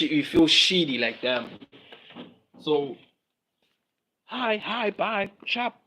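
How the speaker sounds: ordinary talk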